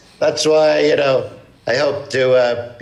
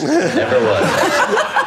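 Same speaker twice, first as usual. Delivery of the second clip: very slow, much higher laughing sneer; mocking laugh; much slower than usual. Trump impersonator mocks his son's hands, laughing slow. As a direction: drag it out laughing.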